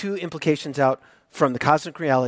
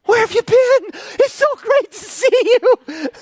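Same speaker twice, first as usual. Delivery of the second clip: high, loud, squealing dog welcome with laughter; be the dog overjoyed you came home; excited dog greeting; 5 dB louder than usual. Retold or imitated. Dog Arwen greets owner back at door, high squealing joy. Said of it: "She's just like".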